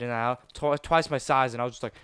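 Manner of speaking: ordinary talk, nothing marked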